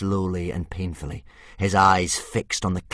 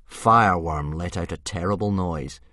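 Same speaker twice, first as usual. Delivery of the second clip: usual speaking voice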